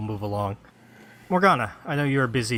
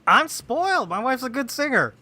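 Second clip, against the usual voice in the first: high mock voice; 13 semitones higher than usual. Mock bragging about wife.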